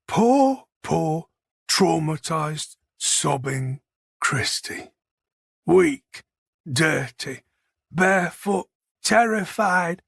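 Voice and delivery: drawn-out mock pity; sneering fake sympathy, word by word. A narrator's reading. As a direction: taunt with slow, separated words, swooping up on the last. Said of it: "his voice sliding into a mocking approximation".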